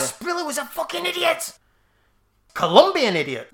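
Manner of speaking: high-pitched comic Geordie outburst; mock Geordie accent. Geordie fan ranting about Asprilla, mock Geordie accent. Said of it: "I would do Geordie say".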